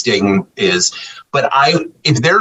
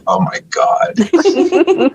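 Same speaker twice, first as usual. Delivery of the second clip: exasperated husband; 14 semitones higher, much slower than usual. Retold or imitated. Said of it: "And he's like".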